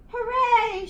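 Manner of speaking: loud, high cheer of joy; joyful shout. A narrator's reading. George cheering on finding the monster, loud joyful shout. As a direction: cheer it out like you've found treasure. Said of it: "shouted George".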